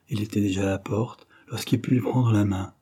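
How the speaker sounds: usual speaking voice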